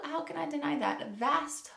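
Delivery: normal talking voice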